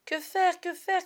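high, loud frantic question; panicked cry. A narrator's reading. The boys cry out to each other, frantic. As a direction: shout it in panic. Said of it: "crièrent les autres".

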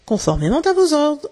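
a touch of unsettled protest; stammered. A narrator's reading. Boatswain answering his captain's challenge, flustered. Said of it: "bredouilla le maître d'équipage".

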